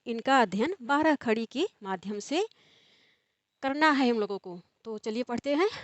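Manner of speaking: usual speaking voice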